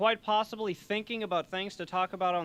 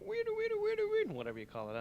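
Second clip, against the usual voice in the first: very high repeated electronic whirr; imitated gadget noise. Host describing the new watch they want, high-pitched vocal effect. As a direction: squeal a looping high gadget sound. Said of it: "I don't know the technical terms".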